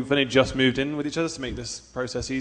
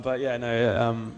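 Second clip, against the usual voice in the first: usual speaking voice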